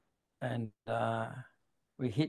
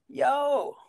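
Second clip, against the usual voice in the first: high, loud call across the water; imitating a loud American "Yo!"; 13 semitones higher, 6 dB louder than usual. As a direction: shout "Yo!" to get his attention. American surfer calls out.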